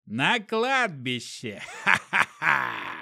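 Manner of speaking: slow villainous cackle; maniacal laugh. A narrator's reading. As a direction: laugh like a mad necromancer. Necromancer's crazy plan.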